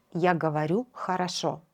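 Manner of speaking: normal talking voice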